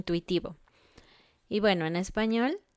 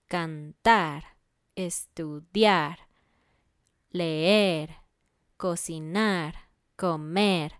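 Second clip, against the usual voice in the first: "cantar, estudiar, leer, cocinar, comer" each drawn out on the last syllable; final-syllable stress; much slower than usual. Shown as a demonstration. Teacher listing infinitives, "cantar" to "comer" stressed on the end. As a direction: list them slowly, leaning on each final -ar or -er. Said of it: "tienen un sonido más fuerte al final".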